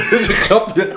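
real giggles breaking up the words; laughing disclaimer. Real, not acted. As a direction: talk through helpless laughter. Laughing while retracting his own joke.

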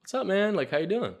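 breezy high "what's up man"; fake-chill hello to a stranger; high casual greeting. Casual greeting to homeless guy.

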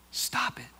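breathy whispered "Stop it". Acted. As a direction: hush them with a whisper. Repeated command, whispered.

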